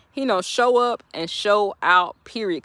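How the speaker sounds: each word laid down slowly; slow, deliberate repeat. Shown as a demonstration. To viewers, a slow emphatic sermon repeat. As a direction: drag it out for weight.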